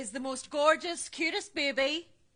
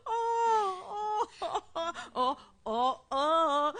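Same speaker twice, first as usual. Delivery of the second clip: cooing; 4 semitones higher, much slower than usual. Acted. Grandmother cooing as baby stirs.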